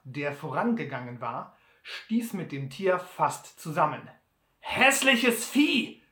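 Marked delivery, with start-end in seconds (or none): 4.6-6.0 laut und höher, sehr langsam ausgerufen; verächtlich ausrufen, als Butler; lauter Ausruf. A narrator's reading. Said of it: "rief er aus". (Butler schimpft laut über das Maultier)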